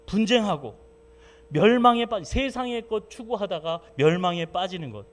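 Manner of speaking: loud, high fervent preaching; preaching at full force. Acted. Pastor preaching fervently to the congregation. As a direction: thunder it from the pulpit.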